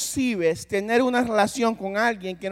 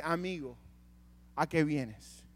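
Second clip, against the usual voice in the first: soft, low and tender, offering friendship; gentle Jesus voice; 5 semitones lower, 6 dB quieter, slower than usual. Acted. Preacher voicing Jesus tenderly to Judas, soft and low. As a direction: speak it like a friend reaching out. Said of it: "Jesús le dice".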